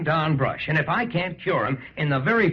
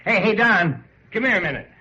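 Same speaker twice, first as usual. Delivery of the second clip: calling out; 5 semitones higher, 4 dB louder than usual. Acted. Babe calling Don across clubhouse.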